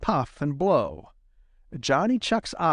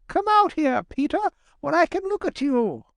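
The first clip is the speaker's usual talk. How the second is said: high-pitched eager little chuck; crying out. A narrator's reading. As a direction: call him out in a high voice. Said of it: "cried Johnny".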